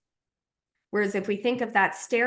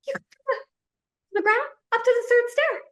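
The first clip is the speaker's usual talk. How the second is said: much higher, louder mock sneer at the fall; mocking squeak; 4 dB louder, faster than usual. Inner critic mocking the fallen toddler in a much higher squeak. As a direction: squeal it out like a snide heckler. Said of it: "No, you go".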